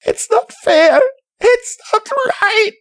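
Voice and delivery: high-pitched, tearful protest; anguished, squeaky crying voice. A narrator's reading. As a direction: wail it through sobs. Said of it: "crying and sniffling too".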